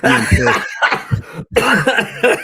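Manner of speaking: laughing while telling the joke. Real, not acted. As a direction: push the words out through laughter.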